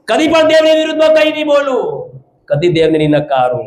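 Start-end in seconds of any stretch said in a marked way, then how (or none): 0.0-2.1 high, ringing vow; fervent pulpit proclamation; forceful preaching. Real, not acted. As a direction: declare it like a preacher at full pitch.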